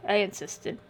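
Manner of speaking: plain reading voice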